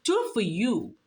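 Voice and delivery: ordinary delivery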